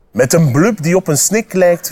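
usual speaking voice

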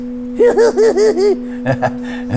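very high squeaky giggle; laughing. A narrator's reading. Pip and Mika laughing together, high giggle. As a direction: giggle in a high hee-hee string. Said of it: "Lachten Pip en Mika".

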